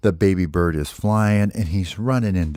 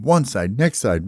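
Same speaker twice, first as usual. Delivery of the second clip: ordinary delivery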